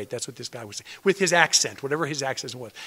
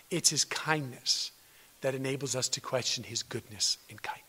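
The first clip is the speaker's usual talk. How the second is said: soft whisper, much softer; 5 semitones lower, 9 dB quieter than usual. Real, not acted. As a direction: hush it to the congregation. Preacher whispers key point.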